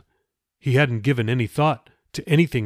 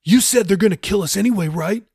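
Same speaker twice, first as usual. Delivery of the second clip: quick, high cut-in; interrupting; 9 semitones higher, 5 dB louder than usual. A narrator's reading. Jeffy cuts Raoul off mid-sentence, quick and high. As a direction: jump in fast and insistent. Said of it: "Jeffy interrupted".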